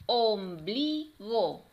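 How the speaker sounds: slow "Om-bli-go" in three beats; teacher counting out each syllable of "Ombligo". Shown as a demonstration. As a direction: split "Ombligo" with clear gaps. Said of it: "vamos a separar cada una".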